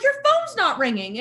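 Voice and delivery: high, loud indignant protest; high, loud disbelief at the roommate. Retold or imitated. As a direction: call out his fake excuse. Calls out fake phone call.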